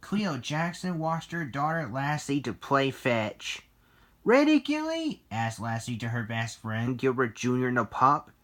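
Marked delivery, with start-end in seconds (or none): 4.2-5.2 put-on high, loud, excited girl voice; eager question. Lassie asks her best friend to play, high and eager.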